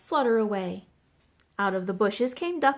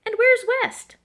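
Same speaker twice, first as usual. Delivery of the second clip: high, loud demand for directions; startled question; 13 semitones higher, 7 dB louder than usual. A narrator's reading. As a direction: ask sharply, pitch jumping up. Goose questions Bluebird, taken aback.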